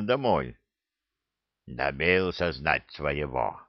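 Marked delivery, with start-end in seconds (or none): 1.7-3.6 low, slow concession; resigned elder. A narrator's reading. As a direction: concede slowly in a low old orc's voice. Stunned elder concedes to his son. (низко, медленно, смиряясь)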